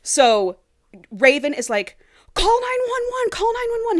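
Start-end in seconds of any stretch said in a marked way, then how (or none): 2.3-4.0 high, loud, frantic cry for help; panicked yelling. Retold or imitated. Raven yelling at Joey to call for help, frantic and high. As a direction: shout it like someone's bleeding out.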